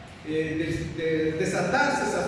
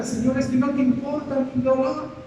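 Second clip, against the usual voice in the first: anguished plea; 6 semitones higher than usual. Acted. Believer cries out to God.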